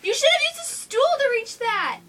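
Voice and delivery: high, loud scolding; angry mom. A narrator's reading. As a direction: scold him loudly for the mess. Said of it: "yelled his mom".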